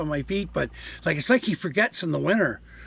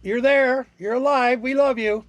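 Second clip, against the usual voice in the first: higher, louder teasing reply to the gadget; mock exasperation; 9 semitones higher, 4 dB louder than usual. Real, not acted. Answering the beeping device, teasing and exasperated. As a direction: humor the nagging beeper.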